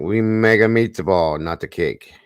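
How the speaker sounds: slow, hammed-up Italian accent. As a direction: play a pizzeria owner, Italian accent. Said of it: "In an Italian accent".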